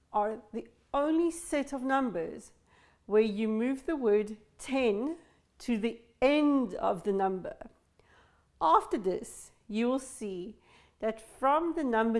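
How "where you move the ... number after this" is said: usual speaking voice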